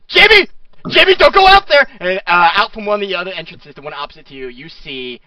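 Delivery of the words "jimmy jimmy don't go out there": high, loud desperate calling; shouting after someone. Acted. The giant skull shouts after Jimmy to stop, loud and high. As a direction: yell after the runaway child.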